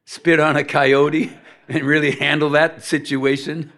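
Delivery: real laughter breaking into the line; amused laughing. Real, not acted. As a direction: crack up at your own joke. Laughs while joking about llama.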